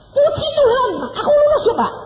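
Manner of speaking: high and loud, bursting with joy; elated cry of praise; joyful laughing relief. Retold or imitated. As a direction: shout thanks through laughter. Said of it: "Ketawa dia saudara".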